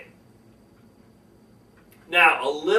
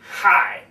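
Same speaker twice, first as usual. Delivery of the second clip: punchy, louder HI; abrupt, forceful "Hi" at usual pitch; hi in another tone; 5 dB louder than usual. Shown as a demonstration. Speaker showing another tone of hi, abrupt loud "Hi". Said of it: "Another word you could say is like".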